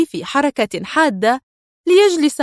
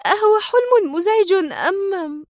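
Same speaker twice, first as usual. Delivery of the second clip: high, anxious, tearful asking; fretting over him; worried question; 10 semitones higher than usual. A narrator's reading. The wife asking her husband about a bad dream, worried. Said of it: "سألته في قلق".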